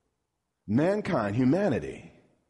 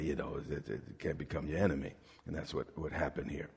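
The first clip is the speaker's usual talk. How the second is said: much softer and lower, almost murmured; hushed; 12 semitones lower, 11 dB quieter than usual. Real, not acted. Preacher lowering his voice to warn the congregation. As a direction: drop it down low and close.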